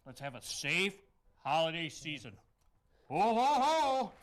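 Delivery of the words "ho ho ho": loud, rising ho-ho-ho; Santa belly laugh. Pretend. Santa closes his safety advice, jolly ho-ho-ho. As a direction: boom it out as Santa.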